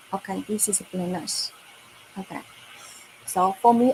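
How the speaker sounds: normal talking voice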